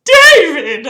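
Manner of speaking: loud happy shout of a name; joyful scream. Acted. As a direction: call out his name with joy. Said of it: "I screamed".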